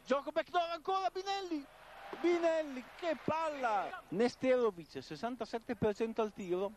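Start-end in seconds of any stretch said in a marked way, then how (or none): 0.0-4.0 much higher, stretched exclamation at the peak; breathless italian goal commentary. Real, not acted. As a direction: rise to a breathless call. Play-by-play climax.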